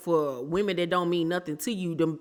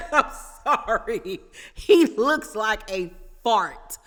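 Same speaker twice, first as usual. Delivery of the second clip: giggling through the insult; laughing, louder and slower; 4 semitones higher, 3 dB louder, slower than usual. Real, not acted. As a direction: repeat it slowly while still laughing.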